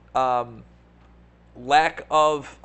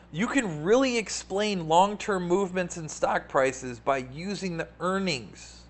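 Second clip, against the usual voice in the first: ordinary talk, nothing marked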